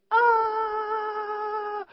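long, high, loud scream; re-enacted pain scream. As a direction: hold a piercing yell of agony. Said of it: "I mean, I yelled so loud".

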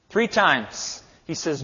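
ordinary talk, nothing marked

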